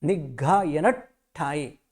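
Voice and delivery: निघायन ठाए very slow; slow clear निघायन ठाए; slow recitation. Shown as a demonstration. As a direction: stretch निघायन ठाए syllable by syllable. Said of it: "य न और आधा ट".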